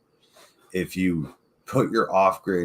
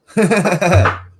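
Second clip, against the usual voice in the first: sudden high burst of laughter; loud laugh; 13 semitones higher, 10 dB louder than usual. Real, not acted. Friend cracking up at a duck-eating joke, loud laugh.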